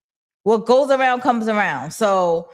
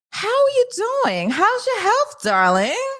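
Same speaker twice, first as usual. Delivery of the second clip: much higher, sing-song fake sweetness; sugary sweet deflection; 13 semitones higher, slower than usual. Mocking Flossie dodging Stefano, in a much higher saccharine voice. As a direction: pile on the syrupy politeness to change the subject. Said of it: "talks about something sweet".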